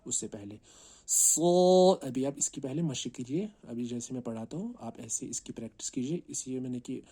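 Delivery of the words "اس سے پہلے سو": ordinary delivery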